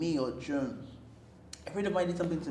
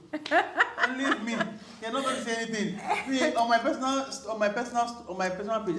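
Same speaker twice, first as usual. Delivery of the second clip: higher-pitched, laughing protest; laughing plea; 7 semitones higher, 4 dB louder than usual. Real, not acted. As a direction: plead through real laughter. Joking plea about posting.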